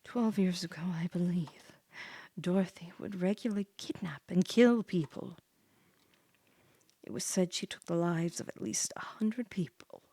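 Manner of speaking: hushed spooky whisper; a narrator whispering of the cruel witch; whispered tale. Acted. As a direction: whisper it like a ghost story. Whispered scary backstory.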